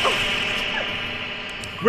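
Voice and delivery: usual speaking voice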